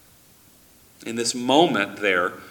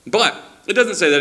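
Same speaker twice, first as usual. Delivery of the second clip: ordinary talk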